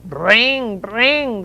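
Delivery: ringing, drawn-out bell trills; imitated bicycle bells. A narrator's reading. Boys on bicycles ring their bells, ringing imitation. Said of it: "the boys rang their bells".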